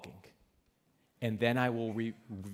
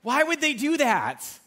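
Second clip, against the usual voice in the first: high, loud exasperated groan of a question; mock dismay; 12 semitones higher, 9 dB louder, slower than usual. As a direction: act baffled and put out.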